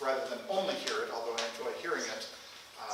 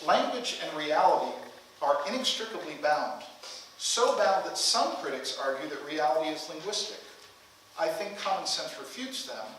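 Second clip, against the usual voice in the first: formal reading aloud; 3 semitones higher, 4 dB louder than usual. Acted. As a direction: read the passage out deliberately, with weighted phrases. Reads a book passage aloud.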